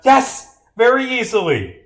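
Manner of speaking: loud, high burst of certainty; triumphant claim. Acted. Detective answers whether it can be proved, triumphant.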